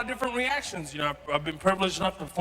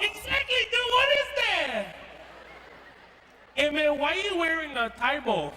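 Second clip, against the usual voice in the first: schoolkid; 8 semitones higher than usual. Acted. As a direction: poke fun at the new kid, high-pitched. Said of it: "this other kid walks up and he's like".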